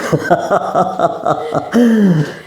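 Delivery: a high burst of laughter at his own boast; amused laugh. Acted. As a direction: laugh off the vanity. Rit après "je suis célèbre. (rire amusé et aigu)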